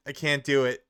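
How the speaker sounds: ordinary talk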